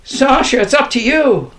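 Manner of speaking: loud, high urgent appeal; high, rallying plea; exhorting. A narrator's reading. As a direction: urge him on, calling high. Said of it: "I exhorted my little brother".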